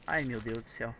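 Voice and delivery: ordinary delivery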